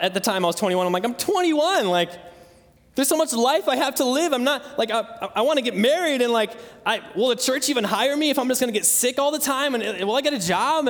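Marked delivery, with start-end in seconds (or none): 1.0-10.9 retold desperate plea, pitched high; re-enacted anguished protest to God; crying out. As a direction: plead with God in a raised pitch. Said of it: "crying out to God".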